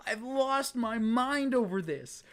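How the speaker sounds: high pleading begging voice; high-pitched mock-desperate begging; desperate plea. Real, not acted. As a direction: beg the game for a win. Begging the game.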